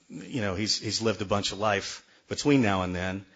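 ordinary delivery

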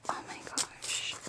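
whispered, overwhelmed amazement; real hushed whisper; awed whisper. Real, not acted. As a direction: whisper it to yourself in awe. Overwhelmed, speaking to herself.

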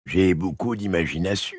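a hint of an old witch. The witch speaks.

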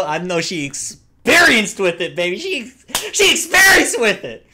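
loud and climbing to a high squeal; hyped-up leering. Real, not acted. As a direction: get carried away, ending in a yell. Hyped innuendo about character.

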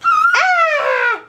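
very high playful shriek; mock scream. Mother screaming as part of peekaboo game, playful shriek. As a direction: squeal in pretend fright. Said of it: "You scream when you move it".

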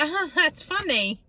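high, lightly mocking friend voice; imitating the friend. Retelling a friend's odd comment at the shops, mild mock voice. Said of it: "She's like".

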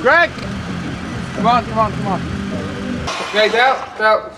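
calling out. Real, not acted. Calling out to Greg.

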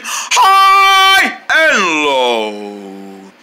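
drawn-out slide from top to bottom of the voice; high sliding to low. Shown as a demonstration. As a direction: show off the whole range, very slowly. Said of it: "You see how my voice is working?".

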